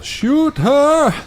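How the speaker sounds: high, loud yell while being shocked. Acted. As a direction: cry out, hammed-up, mid-electrocution. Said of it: "I'm gonna yell".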